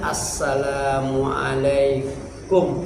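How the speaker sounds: assalamualaikum drawn out very slowly and evenly; slow salam. Shown as a demonstration. Teacher shows the class how to give salam, very slow. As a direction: stretch every syllable of assalamualaikum, slow and soft. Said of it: "Jadi salam gimana?".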